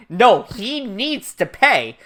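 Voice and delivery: loud, slow, emphatic demand; hammed-up righteous outrage, word by word; imitated vengeful insistence. Voicing Matsuda's vengeful resolve.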